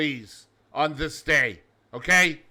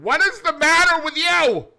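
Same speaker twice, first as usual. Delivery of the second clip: squeaky high, loud cartoon crocodile; 12 semitones higher, 9 dB louder than usual. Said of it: "He then asks the lizard".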